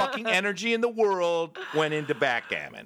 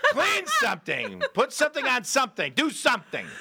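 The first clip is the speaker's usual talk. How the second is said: raised-pitch mock pleading orders; going nutty; 8 semitones higher than usual. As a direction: command anyone to do anything, high and stir-crazy. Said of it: "You get a little nutty".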